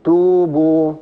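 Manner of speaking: توبوا with both oo vowels drawn out evenly; lengthened vowels. Shown as a demonstration. Teacher showing two madd waws, lengthened vowels. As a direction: hold each waw in توبوا as a long oo. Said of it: "وقابلية التطويل وقابلية الزيادة".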